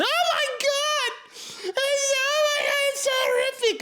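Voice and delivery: very high panicked squeal; freaked-out kid. Retold or imitated. Chubby eleven-year-old freaking out at the slaughter, high panicked shriek. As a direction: shriek it like a terrified little boy. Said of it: "I'm freaking the fuck out as a little chubby eleven-year-old going".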